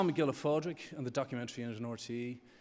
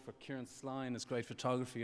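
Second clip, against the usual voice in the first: ordinary talk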